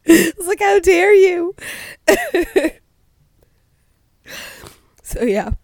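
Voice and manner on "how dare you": high, loud indignant cry; imitated indignation through laughter, pitched up; mock outrage. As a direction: shriek it in hammed-up offense. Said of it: "I was so angry".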